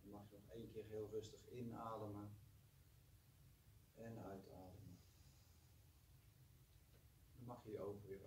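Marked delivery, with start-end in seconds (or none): 0.0-5.0 guided meditation. Acted. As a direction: gently guide them to relax. Leads a short meditation.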